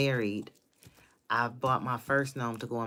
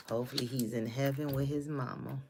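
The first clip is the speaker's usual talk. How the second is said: ordinary delivery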